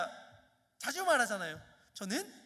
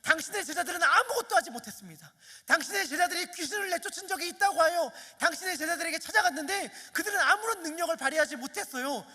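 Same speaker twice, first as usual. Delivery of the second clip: higher, louder, fast exasperated complaint; doubtful, frustrated challenge; 5 semitones higher, 5 dB louder than usual. Acted. Father's inner doubt toward Jesus, voiced fast and exasperated. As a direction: push back at him, half-doubting. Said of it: "아마 그의 속마음은 이랬을 거예요".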